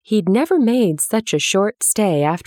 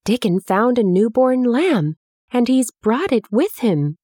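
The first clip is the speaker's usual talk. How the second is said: eager news; 6 semitones higher than usual. A narrator's reading. Mary shares eager news.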